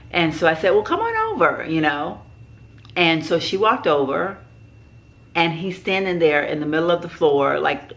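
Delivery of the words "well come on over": much higher, airy phone invite; acted-out pointed invitation, high-pitched; retold breezy invitation. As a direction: mimic a casual invitation, pitch leaping up.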